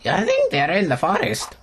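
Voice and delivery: mock squeaky lady voice; a hint of a squeaky village mother. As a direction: imitate her squeaky voice lightly. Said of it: "she was kinda like a female voice and squeaky".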